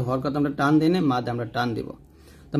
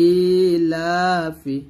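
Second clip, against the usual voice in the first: very slow, each syllable stretched; drawn-out reading demo; much slower than usual. Shown as a demonstration. Teacher slowly sounding out a joined word for pupils. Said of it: "এরকম ভাবে পড়তে হবে".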